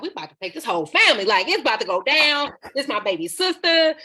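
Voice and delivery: loud, rising, riled-up uncles; retold angry threat. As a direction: act out the uncles ready for a fight. Armed uncles threatening revenge, retold.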